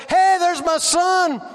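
loud, high, slow happy hello; joyful greeting. Retold or imitated. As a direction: greet him like a proud father. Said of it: "it would be like".

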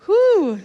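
high loud whew, letting out the emotion; a big relieved whew; relieved exhale. Real, not acted. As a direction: blow out after a moving moment. Recovering after moved by worship.

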